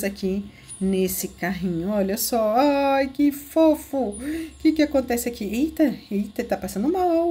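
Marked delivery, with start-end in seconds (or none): none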